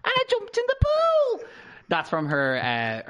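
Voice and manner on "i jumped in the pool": put-on high, slow [name] accent; loud squeaky [name] accent; [name] impression. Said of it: "I was gonna ask you to do an accent".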